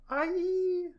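piercing high shriek; shrill comic scream. Acted.

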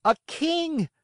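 put-on high excited child voice saying a king; children's eager guess. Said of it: "my little readers will say immediately".

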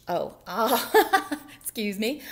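loud oh breaking into laughter; laughing. Real, not acted. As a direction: laugh while excusing yourself. Reader laughs at own slip.

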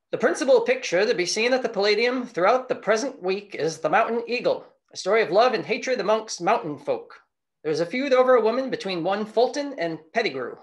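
put-on old-time radio announcer reading a review; 1920s newsreel announcer delivery; Mid-Atlantic radio voice. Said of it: "you got that good Mid-Atlantic radio voice".

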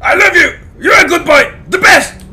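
high, loud shouted praise; a playful shout at a pet dog; mock shout at a dog. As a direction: yell the praise like a scolding. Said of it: "Just like you said".

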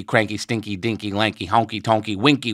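ordinary delivery

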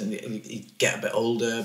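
ordinary talk, nothing marked

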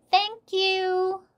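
high, loud singsong call. A narrator's reading. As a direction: call it to someone far away. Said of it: "called Annie".

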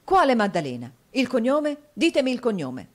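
loud, high, agitated questioning; urgent demand. A narrator's reading. Newman pressing his friend for the surname, urgent demand. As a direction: press hard for an answer, rising in pitch.

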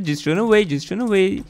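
normal talking voice

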